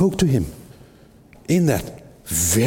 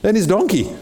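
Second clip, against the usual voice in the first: puzzled onlookers; 10 semitones higher than usual. Acted. Onlookers wondering aloud.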